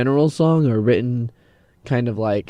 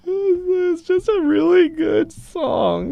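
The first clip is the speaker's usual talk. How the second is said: mock crying. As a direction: ham up being moved to tears. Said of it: "I can't tell if you're being sarcastic".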